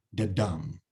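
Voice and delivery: weak the then strong dum; da-DUM rhythm; stress on dum. Shown as a demonstration. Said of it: "So the is unstressed, dum, stressed".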